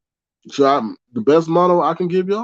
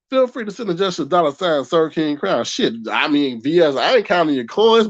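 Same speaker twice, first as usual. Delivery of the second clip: normal talking voice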